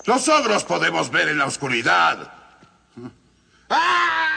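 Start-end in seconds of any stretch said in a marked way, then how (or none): 3.7-4.3 high shouted cry of falling; falling scream. Acted. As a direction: scream as you drop. El ogro cae en la oscuridad. (grito al caer)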